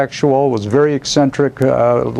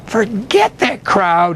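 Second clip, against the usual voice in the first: higher, slow dismissive scowl; 7 semitones higher, slower than usual. A narrator's reading. Old mentor waving off the critics, scowling. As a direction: brush it off with a scowl. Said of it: "he scowled".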